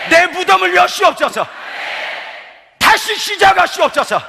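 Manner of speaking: loud, high, fervent prayer shout; impassioned revival preacher cry; full-force preaching. Real, not acted. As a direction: preach it at the top of your voice.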